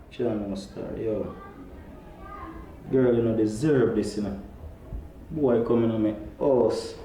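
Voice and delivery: low confiding man. Acted. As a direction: confess it to Father.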